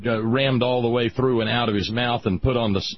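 ordinary delivery

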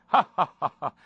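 loud high ha-ha-ha; hearty dad laugh. Acted. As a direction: laugh it big and jolly. Father laughs in skit.

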